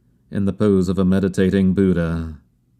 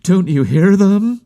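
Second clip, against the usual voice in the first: high, louder, urgent question; near outcry; 12 semitones higher, 5 dB louder than usual. A narrator's reading. Narrator desperate to make her hear the words, high outcry. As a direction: cry it out high, swooping up at the end. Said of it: "on the point of crying at her".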